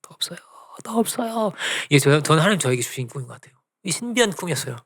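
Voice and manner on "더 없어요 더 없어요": soft, high mock-weeping plea; tearful pleading. Preacher crying in his dream for more, retold plea. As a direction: repeat it weakly, acting out tears. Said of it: "이러는 거예요".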